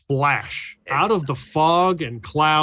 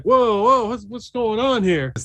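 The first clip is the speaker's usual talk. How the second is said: raised, startled whoa; 8 semitones higher than usual. Acted. Startled man dropping the cigarettes, raised alarm. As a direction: flinch and blurt it. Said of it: "He's like".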